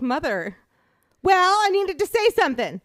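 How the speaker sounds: high, loud mock protest to mom; exasperated retort. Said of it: "I was like".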